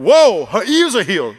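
high, loud, drawn-out retold exclamation; loud astonished "whoa"; amazed outburst. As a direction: exclaim it big, as if retelling a miracle. Retelling his amazed outburst.

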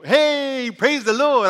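hammed-up church cheer, high and loud; exuberant praise greeting. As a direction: call out a mock-joyful hello, pitched high. Mimics cheerful church greeting he doesn't feel.

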